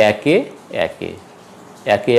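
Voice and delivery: একে starting with open "ae" as in "cat"; একে pronounced "AE-ke"; open initial vowel. Shown as a demonstration. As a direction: say একে as "aeke". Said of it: "উচ্চারণ হবে".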